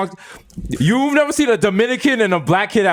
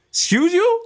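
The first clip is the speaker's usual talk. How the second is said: high mock offense; 12 semitones higher, slower than usual.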